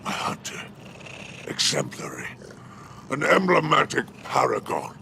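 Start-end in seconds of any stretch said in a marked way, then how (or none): none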